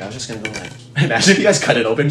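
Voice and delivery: normal talking voice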